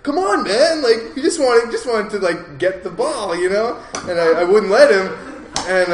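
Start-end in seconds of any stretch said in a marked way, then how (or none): 0.0-3.8 much higher, louder whiny plea; mock begging for the ball; pleading dog guy. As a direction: beg like a pouting puppy. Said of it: "he ends up being like".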